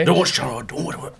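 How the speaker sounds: put-on failed stab at a Scottish accent; attempted Scottish accent. Imitating the old man at the factory, attempted Scottish accent. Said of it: "I can't do the Scottish accent".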